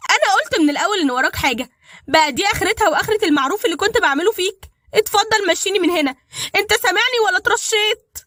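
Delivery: high, loud furious accusation; angry. A narrator's reading. Dalida to Badr, thinking he kidnapped her, angry. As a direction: yell at him for kidnapping you. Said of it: "متعصبة وقالت".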